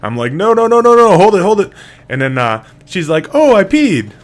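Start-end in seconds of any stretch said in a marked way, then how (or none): 0.3-1.7 high, loud alarmed no-no-no; mock-panicked dad yelling. 3.3-4.1 put-on high, loud little-girl announcement; lightly voiced little girl; toddler voice. Daughter announces.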